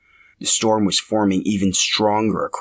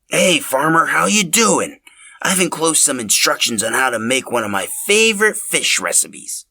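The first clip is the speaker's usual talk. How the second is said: Linus's letter voice; 7 semitones higher, 3 dB louder than usual. Acted. Linus's letter read aloud.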